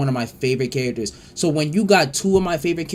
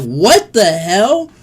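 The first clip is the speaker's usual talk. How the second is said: loud, higher and slowly drawn out in shock; stunned "what the hell"; 7 semitones higher, 8 dB louder, much slower than usual. Retold or imitated. Kid watching Cell drink a man, loud slow shocked cry. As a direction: stretch it out, horrified and amazed. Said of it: "You're like".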